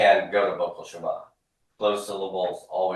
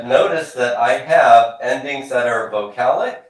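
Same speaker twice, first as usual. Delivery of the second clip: ordinary talk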